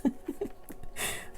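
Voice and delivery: soft helpless giggle; giggling. Real, not acted. Reading a dirty riddle to viewers, soft helpless giggle. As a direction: laugh despite yourself. Can't help laughing at the riddle.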